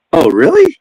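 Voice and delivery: ordinary delivery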